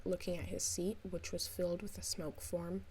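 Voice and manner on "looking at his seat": ordinary delivery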